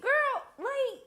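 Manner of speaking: put-on high, incredulous scolding of the girl; high mock-scolding girlfriend voice; sassy disbelief. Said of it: "I was like".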